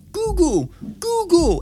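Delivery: imitating his small daughter calling out; toddler calling. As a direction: call out like a tiny child at the wall speaker.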